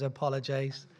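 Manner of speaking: normal talking voice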